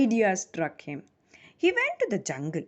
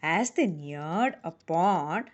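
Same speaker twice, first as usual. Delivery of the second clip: usual speaking voice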